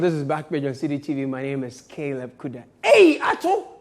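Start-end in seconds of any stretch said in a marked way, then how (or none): 2.8-3.7 high, loud laughing call; catchphrase shout. Pretend. Host hails Ato with a loud laughing shout. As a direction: call out Ato across the room.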